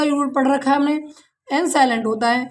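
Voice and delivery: ordinary talk, nothing marked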